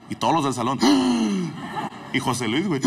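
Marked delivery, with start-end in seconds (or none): 0.8-2.1 shocked gasp. Retold or imitated. Classmates shocked.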